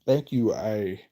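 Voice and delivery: ordinary talk, nothing marked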